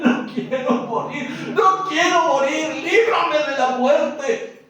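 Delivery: loud, high anguished begging; weeping plea. Acted. Jesus weeping and clamoring to the Father, loud high anguished plea. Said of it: "Él está llorando, está clamando".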